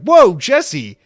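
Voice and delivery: loud, high mock outrage; mock shocked whoa. Listeners mock-outraged at the host. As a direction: gasp it out like an offended fan. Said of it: "it's like".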